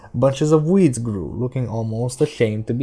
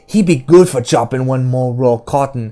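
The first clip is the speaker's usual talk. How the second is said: loud, raised taunting character voice; Detta, mocking; 3 semitones higher, 5 dB louder than usual. A narrator's reading. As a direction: jeer at them in a heavy dialect.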